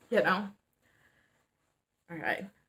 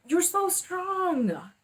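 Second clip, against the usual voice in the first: much higher, slow sing-song praise; mock reassurance; 10 semitones higher, much slower than usual. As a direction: mimic a patronising cheerleader. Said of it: "another way of saying this is".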